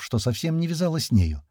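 plain reading voice

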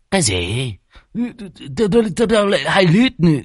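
high, startled stammering; indignant disbelief. Acted. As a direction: sputter the repeated tao in outrage.

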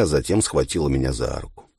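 usual speaking voice

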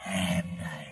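slow, eerie ghost voice; dead Đủ whispering that he has come for Đạo; ghostly whisper. Acted. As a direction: draw out each word like a ghost. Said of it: "có tiếng thì thào".

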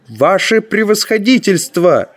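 high, insistent exclamation; insistent protest. A narrator's reading. Ferl will not let His Excellency be persuaded, insistent protest. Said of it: "не унимался Ферл".

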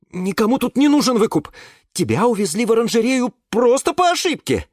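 a nervous student insisting; young student Yura. Acted. Yura's voice in the story. (Юра оправдывается, высоким голосом)

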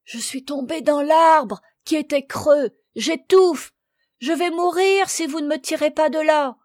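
high, loud, desperate plea; stifled cry. A narrator's reading. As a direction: cry from inside a hollow tree. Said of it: "d'une voix étouffée".

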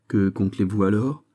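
ordinary delivery, nothing marked

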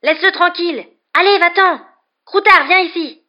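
high, loud angry yelling; frantic high-pitched bellowing; furious shouting. A narrator's reading. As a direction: yell furiously to drive it off. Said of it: "les cris furieux qu'il lançait".

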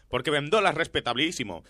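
normal talking voice

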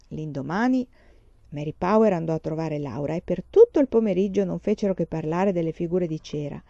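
ordinary delivery

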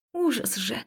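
ordinary delivery, nothing marked

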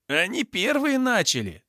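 high-pitched aggrieved protest; acted indignant complaint. A narrator's reading. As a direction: protest high, blaming the others. Thug complains to guards. (обиженная жалоба высоким голосом)

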